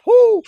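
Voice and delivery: high, loud "woo" between laughs; high whoop. Real, not acted. Comedian cracking up at his own bit, high whoop. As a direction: let out an amused whoop.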